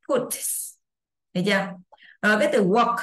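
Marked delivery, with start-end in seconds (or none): 0.1-0.3 "put" ending in a hissed s, as puts; final s sound. Shown as a demonstration. Teacher repeating for students, "put" with hissed final s. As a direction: let the t of "put" release into an s. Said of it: "nó sẽ đọc là âm sờ".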